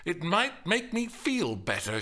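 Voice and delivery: high-pitched defiant comeback; assertive retort. A narrator's reading. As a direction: insist on it, piqued. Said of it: "she asserted".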